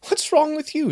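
high, loud cat snarl. Acted. Barn cat snarls at the kicking horse, high and loud. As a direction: spit it at the horse like a cornered cat. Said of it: "she snarled toward the horse".

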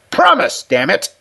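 sudden high, loud insistence; angry demand. Acted. Richardson shakes the old man hard, fiercely insistent. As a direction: burst out demanding his word.

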